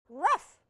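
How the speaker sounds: single high, loud yap; puppy bark. Acted. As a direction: give one sharp puppy bark. Puppy barks in story.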